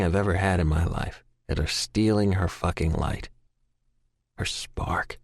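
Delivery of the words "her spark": whispered. A narrator's reading. Grieving narrator's inner thought.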